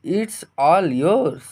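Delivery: very slow model phrase. Shown as a demonstration. Teacher dictating the English phrase very slowly. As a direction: stretch each word out slowly. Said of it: "क्या कहेंगे?".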